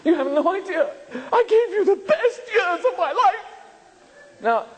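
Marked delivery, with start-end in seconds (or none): 0.1-3.5 mock angry spouse. Spouse mock-scolding their partner, hammed-up angry rant. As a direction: play the wronged spouse, half laughing.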